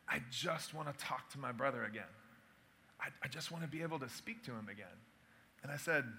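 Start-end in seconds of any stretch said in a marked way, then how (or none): 0.1-5.0 soft, low retold longing; hushed, imitated plea; quiet heartfelt plea. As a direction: say it low and softly, as a brother's wish. Brother's wistful plea, retold softly.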